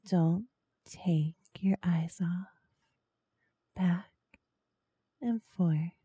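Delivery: whispering. Acted. Hypnotic instruction whispered.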